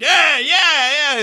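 high-pitched whooping "yeah"; excited cheering. Retold or imitated. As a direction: imitate a friend all into it. Friend loving his lap dance.

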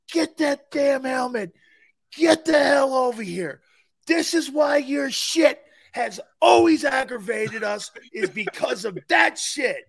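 loud, slow, furious bark; mock coach yelling. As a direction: chew out a player. Coach yelling at angry player.

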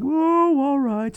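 high, reluctant "oh, all right"; grudging mock sigh. Doctor Mommy caving to her husband, grudging and high. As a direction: give in with a put-upon sigh. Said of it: "And she's like".

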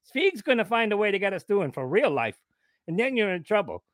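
high, ranting character voice; put-on aggrieved rant, swooping high; indignant character Dubs. Plays offended Dubs character.

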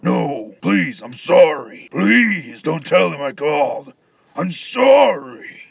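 loud, high, desperate pleading; panicked begging. Acted. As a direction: beg frantically at full volume.

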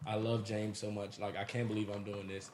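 put-on soft, low lovesick girl voice. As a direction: murmur it like a guilty girlfriend. Voicing a girl who cheats.

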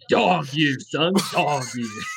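gruff catchphrase voice, played up; put-on catchphrase voice. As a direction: say it like a tough mentor.

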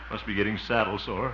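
usual speaking voice

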